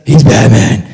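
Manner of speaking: slow, husky superhero growl; Batman. Acted. Explaining why he fights crime every night, in a slow gruff hero voice. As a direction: growl it slowly for emphasis. Repeats the Batman voice, then normally.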